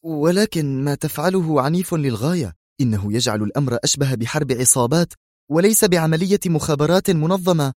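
anxious, high-pitched protest; tense. A narrator's reading. Colleague objecting to the violent plan, tense. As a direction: object with nervous strain. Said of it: "ثم قال في توتر".

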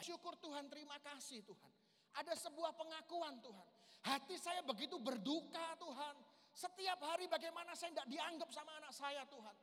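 loud, impassioned prayer, pushed high; fervent cry to God. Acted. Father crying out to God about his rebellious son, loud and impassioned. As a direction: pour out the heartache to God at full voice.

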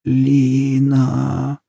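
put-on thin, shaky high old voice; frail old woman calling. A narrator's reading. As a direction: call out weakly like a sick grandmother. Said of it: "Слабый, дрожащий старческий голос".

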